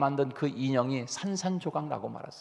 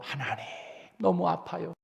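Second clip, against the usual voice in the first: tearful prayer; 6 semitones higher than usual. Retold or imitated. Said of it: "우리가 눈물로".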